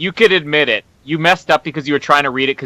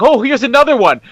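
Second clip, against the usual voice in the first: high, mock-casual quote; raised-voice playful mimicry; imitating the co-host; 9 semitones higher, 3 dB louder than usual. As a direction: pitch it well up, imitating him. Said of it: "And then you're like".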